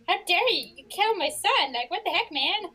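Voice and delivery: high, loud hammed-up fury; hammy angry father; mock outrage. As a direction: scold the bully for the son. Father furious son killed.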